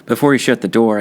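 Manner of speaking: usual speaking voice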